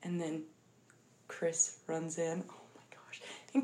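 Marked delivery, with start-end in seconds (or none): 2.5-3.2 breathless whisper; real awed whisper. Real, not acted. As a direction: whisper it, overcome. Overcome by sad scene.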